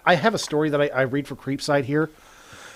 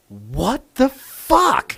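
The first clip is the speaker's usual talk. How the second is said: drawn-out, slow, higher shocked "what the fuck"; shocked manager; 9 semitones higher, 3 dB louder, much slower than usual. Retold or imitated. Manager seeing the impossible schedule, drawn out in shock. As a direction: stretch it out in disbelief, high. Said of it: "she just goes".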